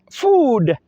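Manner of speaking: food with a high, drawn-out oo; food, long oo sound; long vowel in food. Shown as a demonstration. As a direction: stretch the oo in food. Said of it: "is pronounced the long".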